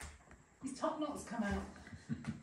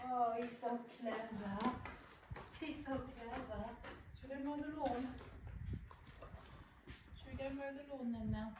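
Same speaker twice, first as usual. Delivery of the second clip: pet talk; 4 semitones higher, 9 dB quieter than usual. Real, not acted. Cooing to the animal.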